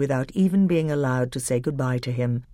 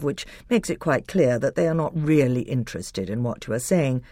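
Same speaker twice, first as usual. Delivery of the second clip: ordinary delivery, nothing marked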